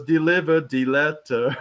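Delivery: slow, mock German accent; drawn-out words with a playful German accent; imitated German accent. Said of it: "almost like in that German accent".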